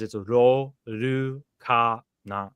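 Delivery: "Ro, Ru, Ka, Na" each syllable said apart with a pause. Shown as a demonstration. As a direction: split "Ro, Ru, Ka, Na" into four separate syllables. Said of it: "it is four characters".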